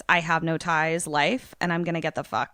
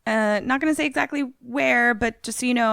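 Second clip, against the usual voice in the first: higher-pitched, casual check-in voice; texting a friend; 7 semitones higher than usual. Acted. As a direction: act out a breezy message, pitched up. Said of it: "to be like".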